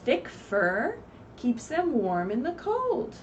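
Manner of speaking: usual speaking voice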